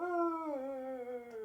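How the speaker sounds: long, high laughing wail; helpless high-pitched laugh. Real, not acted. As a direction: lose it in a drawn-out laugh. Breaks down laughing at own counting.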